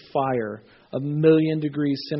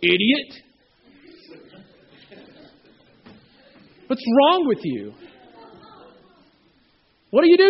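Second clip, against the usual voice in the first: higher, louder mock scolding of a child; mock parent scolding; 8 semitones higher, 7 dB louder than usual. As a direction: play the harsh parent, exaggerated. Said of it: "the parents don't go".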